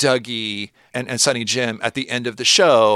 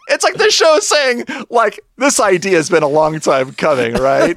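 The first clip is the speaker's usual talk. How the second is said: high and louder, still giggling; laughing through it; 7 semitones higher, 5 dB louder than usual. Real, not acted. Podcaster joking to co-host, still laughing. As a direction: deliver the joke mid-laugh.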